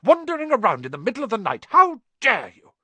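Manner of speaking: high, loud, fast scolding; furious stern teacher; angry shout. A narrator's reading. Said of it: "she shouted".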